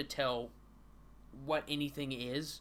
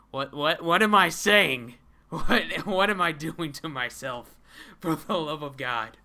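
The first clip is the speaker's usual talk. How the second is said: loud, higher, fed up; exasperated outburst; 3 semitones higher, 12 dB louder than usual. Real, not acted. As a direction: throw your hands up, shouting at yourself. Exasperated at the comic's names.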